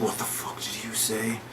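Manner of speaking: slow, low-volume mock menace; softly retold angry challenge, drawn out; quiet anger. Joel confronting Ellie after her remark, quietly angry. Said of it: "like, all, you know, angry".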